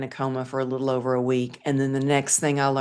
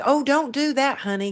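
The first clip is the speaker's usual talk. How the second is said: higher, sweet, shushing nurse voice; a light mock of a kindly nurse, pitched up; imitating the nurse; 10 semitones higher than usual. Nurse hushing the patient pulling at tubes, higher and sweet. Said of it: "the nurse was like".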